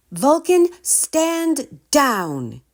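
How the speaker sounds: high, loud command; yell. A narrator's reading. Girl yelling at her dragon to stand down. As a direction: order the dragon to stop. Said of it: "he heard someone yell".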